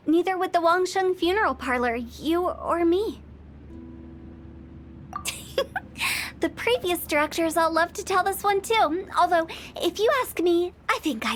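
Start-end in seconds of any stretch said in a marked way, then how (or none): 5.1-6.3 a quiet breathy chuckle; soft chuckle. Acted. Funeral director chuckles at his own grim tale, a soft laugh. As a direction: laugh softly to yourself.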